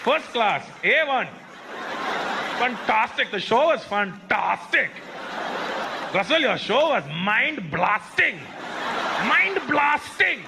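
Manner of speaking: put-on high, stretched-out Indian-accented praise; hammed-up Indian accent, punching each compliment; emphatic Indian accent. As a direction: gush like a fan in a thick Indian accent. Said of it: "They were so emphatic with their compliments".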